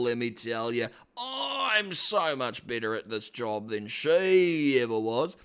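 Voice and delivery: broad, theatrical Aussie dad; hammed-up Australian, bragging to his kid; Australian accent. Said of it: "oh, he's Australian".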